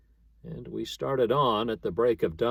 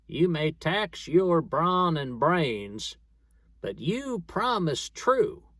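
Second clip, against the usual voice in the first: higher, slow ghostly voice; eerie corpse voice; 6 semitones higher, slower than usual. Acted. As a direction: let the dead man's voice haunt him. The corpse seems to speak.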